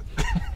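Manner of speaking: quick amused burst; short laugh. Real, not acted. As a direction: laugh at the stink. Laughing at smell.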